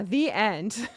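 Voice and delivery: ordinary delivery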